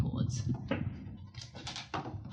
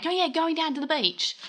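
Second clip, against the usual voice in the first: a playful broad Australian accent on going down to the beach; 7 semitones higher than usual. Shown as a demonstration. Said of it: "don't know why I put an accent with that".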